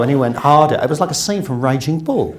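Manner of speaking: ordinary delivery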